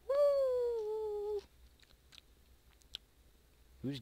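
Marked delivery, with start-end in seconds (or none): 0.1-1.4 very high drawn-out "woo"; high whoop. Real, not acted. Player kicking off a game video, high whoop. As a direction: let out a long, high whoop. Hyped whoop starting the round.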